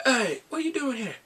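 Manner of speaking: high, loud mock butler challenge; playful imitation of an alarmed Alfred. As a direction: confront the intruder in a put-on voice. And then Alfred's like.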